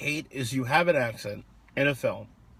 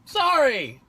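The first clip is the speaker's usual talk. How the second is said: loud, high, stretched-out sorry; exaggerated apology; 12 semitones higher, 6 dB louder than usual. Real, not acted. Co-host who interrupted, exaggerated loud apology.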